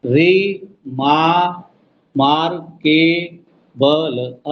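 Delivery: री, मा-, मार्केबल in beats, slow end; syllable split. Shown as a demonstration. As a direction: break रिमार्केबल apart. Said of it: "दोबारा देखो जरा।". (शब्दांश अलग-अलग)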